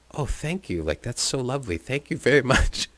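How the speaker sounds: sweet mom voice. Retold or imitated. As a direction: coo it like a delighted mother.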